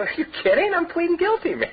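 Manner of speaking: high, cocky mock of his teen self; his younger self. Telling his buddy he won't call dad, high cocky scoff. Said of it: "I go".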